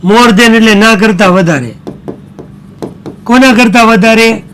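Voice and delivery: ordinary delivery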